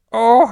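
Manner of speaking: very high, loud mouse squeak; squeaky little cry. Acted. Mouse finding the pot empty, squeaking out loud. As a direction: let out a sharp squeak of dismay. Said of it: "peb musen".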